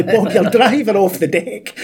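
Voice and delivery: high laughing mock yell; yelling at the telly. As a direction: shout it at the TV, laughing. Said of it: "everybody was screaming at the telly".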